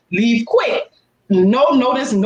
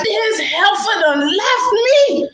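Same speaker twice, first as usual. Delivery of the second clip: much higher, slow, indignant mock yell; mock outrage; 11 semitones higher, slower than usual. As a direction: spell out the betrayal slowly. Retells being abandoned in the stall.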